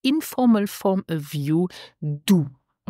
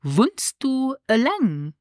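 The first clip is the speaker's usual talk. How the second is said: slow model phrase; 6 semitones higher, slower than usual. Shown as a demonstration. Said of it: "This is".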